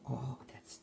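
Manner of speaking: normal talking voice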